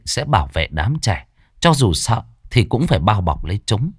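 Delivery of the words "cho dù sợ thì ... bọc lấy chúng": ordinary delivery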